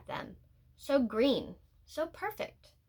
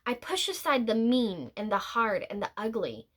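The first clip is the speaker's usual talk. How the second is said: ordinary delivery, nothing marked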